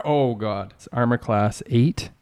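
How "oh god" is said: ordinary talk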